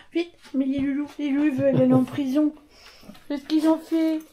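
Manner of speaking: pet talk. Real, not acted. Talking to the dogs playfully.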